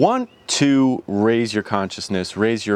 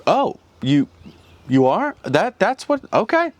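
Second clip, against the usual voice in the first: startled, halting, much higher voice; taken-aback universe; 9 semitones higher than usual. Acted. The universe answering an I am statement, taken aback. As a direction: stammer in high-pitched surprise, then give in. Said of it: "it's like the universe is like".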